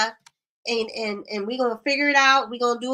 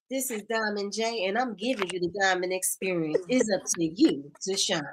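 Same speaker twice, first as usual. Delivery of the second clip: imitating Felicia's intro; 5 semitones lower, 5 dB quieter than usual. Host imitates Felicia's live intro, lower and softer. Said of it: "That's how you say it".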